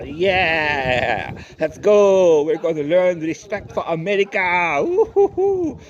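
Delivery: hammed-up whooping cheer, loud and high; a mock rally cry that ends in a high woo-hoo. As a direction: cheer like a pumped-up fan at a rally.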